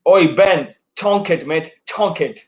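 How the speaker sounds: attempted English accent, calling out to a batsman; best English accent. Player copying the host's cheer for Ben, put-on English accent. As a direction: have a go at an English lad's cheer. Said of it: "in your best English accent".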